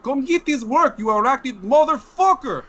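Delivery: loud, high hammed-up Russian accent. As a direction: bellow it like a Russian thug. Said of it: "But I do it my best Russian accent".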